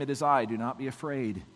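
soft, low and even reassurance; calm, quiet comfort. Shown as a demonstration. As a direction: say it gently, without any force. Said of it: "I said that very calmly".

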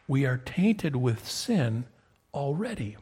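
ordinary talk

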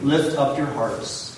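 slow, loud call to the congregation; liturgical call. Real, not acted. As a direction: lift the words out slowly.

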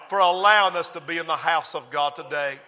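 ordinary delivery

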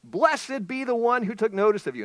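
high, loud, sincere blessing; mean every word of it; heartfelt blessing. Acted. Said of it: "She means it".

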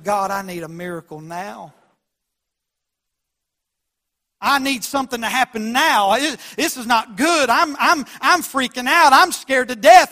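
pleading, rising to a loud, high cry; frantic retold prayer building to a near shout; desperate prayer. Retells his desperate field prayer.

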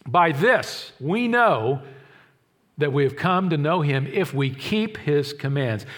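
very slow, measured reading of the verse; preacher quoting John slowly; slow scripture quote. Retold or imitated. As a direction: read the verse slowly, weighing each word. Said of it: "He says".

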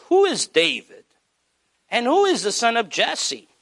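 mocking, dismissive question; scornful Nabal. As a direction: scoff at the name. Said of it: "he goes".

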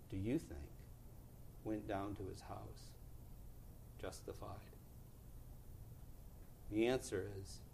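very soft, low preaching with long pauses; hushed sermon close. Real, not acted. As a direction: bring the sermon down to a solemn hush.

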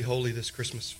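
normal talking voice

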